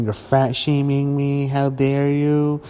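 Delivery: mocking imitation. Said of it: "yelling at you on Twitter and saying".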